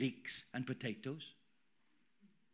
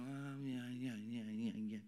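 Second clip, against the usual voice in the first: low, soft, dreary muttering; quiet, low mumble trailing away; mock grumbling; 7 semitones lower, 11 dB quieter than usual. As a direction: mimic a churchgoer sinking into moaning. Mimics same churchgoer grumbling outside.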